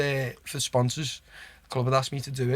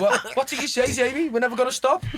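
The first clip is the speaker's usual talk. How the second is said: laughing imitation; 9 semitones higher than usual. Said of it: "He's like".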